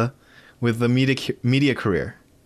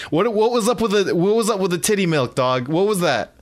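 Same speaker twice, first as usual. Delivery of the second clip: high-pitched, pretend disbelief; mock incredulous questioning; 7 semitones higher than usual. Host pretends to quiz Mark Hamill, mock incredulous. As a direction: pitch it up as if demanding an explanation. Imagined confronting of the actor.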